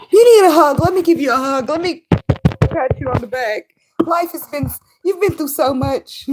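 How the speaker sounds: high, hammed-up babying voice; mock coddling. Mocking herself offering someone a hug, gushing sympathy. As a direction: coo with fake pity, softening after the start. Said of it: "Instead of saying".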